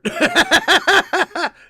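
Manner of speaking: high, loud burst of laughter; loud belly laugh. Real, not acted. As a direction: burst out laughing at your own joke. Laughing at own joke.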